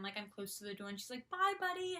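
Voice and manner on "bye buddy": high, singsong farewell; imitated goodbye call. Idol waving to fan at the door, high farewell. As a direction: call it out lightly, pitched up high.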